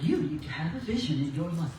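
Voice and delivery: lightly voiced TV newscaster. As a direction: read it like an anchor would. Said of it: "she sounded like a newscaster".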